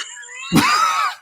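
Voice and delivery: big loud laugh; loud burst of laughter. Real, not acted. Laughs at own story.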